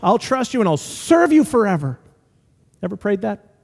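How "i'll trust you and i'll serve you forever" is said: high-pitched, insistent bargaining with God; pleading kid's prayer. Retold or imitated. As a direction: imitate a kid begging in prayer. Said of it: "when I was a kid".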